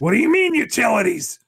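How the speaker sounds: high, loud disbelieving protest; outraged at being made to pay; indignant outburst. Acted. Character outraged at paying.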